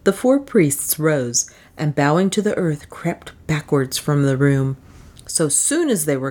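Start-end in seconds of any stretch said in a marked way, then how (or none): none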